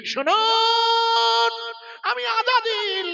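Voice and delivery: high, very slow wailing announcement; dramatic Satan voice. Retold or imitated. Satan declaring his name to the Prophet, wailing high. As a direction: stretch it out like a crying devil.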